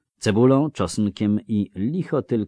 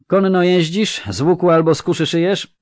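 higher, louder clipped-off questions of a bored officer; brusque recruiter; 12 semitones higher, 12 dB louder than usual. Acted. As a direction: bark the questions like a tired recruiter. (znudzony komisarz, głośno i wyżej)

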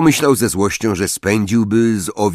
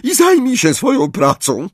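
icy furious order; 10 semitones higher, 4 dB louder than usual. A narrator's reading. Trelawney orders Parvati.

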